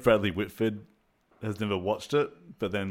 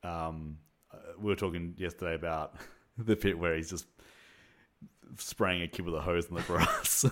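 ordinary delivery

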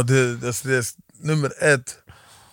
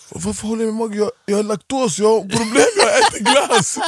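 exasperated protest; 8 semitones higher than usual. Retold or imitated. Man stopped by police while eating ice cream, protesting incredulously. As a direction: voice climbing high and slowing on the last words, incredulous. Retelling own reply to police stop.

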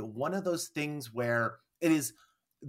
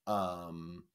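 ordinary talk

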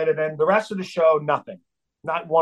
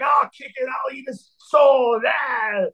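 high-pitched mock-furious shouting; raging yell; 10 semitones higher, slower than usual. Singer trying to get past his bodyguard, raging. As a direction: rant wildly as if being held back.

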